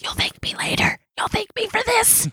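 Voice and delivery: hushed insistent whisper. Pretend. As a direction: whisper it like a secret promise. Pushing book on everyone.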